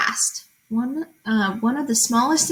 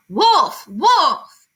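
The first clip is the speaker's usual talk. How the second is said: loud, higher cry of alarm; shouting wolf; 5 semitones higher, 5 dB louder than usual. Acted. Boy fooling the villagers, shouting. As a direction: call out the false alarm. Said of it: "He thought it would be fun to shout".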